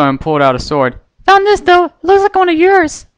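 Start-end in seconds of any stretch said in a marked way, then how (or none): 1.3-3.1 very high, bright cartoon pony voice; high squeaky cheerful pony girl; Pinkie Pie voice. A narrator's reading. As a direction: chirp it like a giddy little pony.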